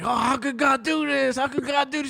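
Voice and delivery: hammed-up despairing cry at God; mock anguished son. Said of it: "He's like".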